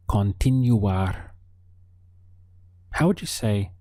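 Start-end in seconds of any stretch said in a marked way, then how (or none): 0.1-1.3 Continuar said slowly, syllable by syllable, con-ti-nu-AR; slow model. Shown as a demonstration. Teacher slowing down Continuar so students can copy. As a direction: stretch Continuar out slowly for learners. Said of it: "Our final verb is to continue".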